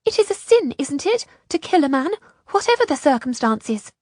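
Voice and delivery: high, upset appeal; distressed, high-voiced question; shaken. A narrator's reading.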